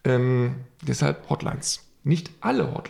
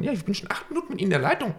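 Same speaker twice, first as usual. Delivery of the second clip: fast, higher mock agent; impatient hotline agent; 6 semitones higher, faster than usual. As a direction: rush it like an annoyed clerk. Quotes annoyed agent.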